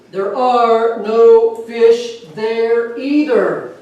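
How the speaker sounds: loud, very slow booming voice from above; a hammed-up voice from above, loud and very slow. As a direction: boom it down from the sky. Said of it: "the voice comes back".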